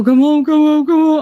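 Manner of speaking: frantic repeated call to come home; urgent phone summons. Retold or imitated. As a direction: retell a panicked call home.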